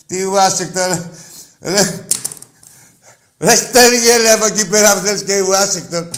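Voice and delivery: loud furious yelling at the caller; angry shout. Real, not acted. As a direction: blast him, full volume. Host yells at rival fan caller.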